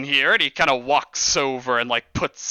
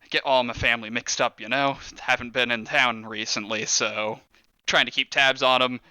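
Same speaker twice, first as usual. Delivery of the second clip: ordinary delivery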